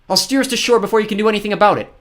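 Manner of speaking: high, loud defiance; defiant girl. Acted.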